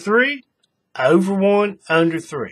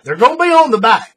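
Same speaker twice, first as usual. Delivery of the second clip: higher, louder, insistent statement; firm insistence; 7 semitones higher, 6 dB louder than usual. Real, not acted. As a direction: lay down the rule with a raised voice. Insists on his firm rule.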